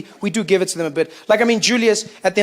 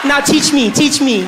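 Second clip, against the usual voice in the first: louder, higher mock begging; eager pleading; 5 semitones higher, 6 dB louder, slower than usual. Corrector begging Jabu after the election, eager mock pleading. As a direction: beg eagerly, hammed-up.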